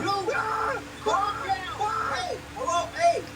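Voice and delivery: high frantic shouts and screams; panicked yelling. Acted. Shouting back at the friend who tells him to calm down. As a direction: yell at him to calm down while screaming yourself.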